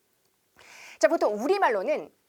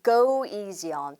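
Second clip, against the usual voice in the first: slow, careful "go easy on"; drawn-out teaching demo; slow model phrase; 3 semitones lower, much slower than usual. Shown as a demonstration. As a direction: lay out each word for the class to copy. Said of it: "한 번 더".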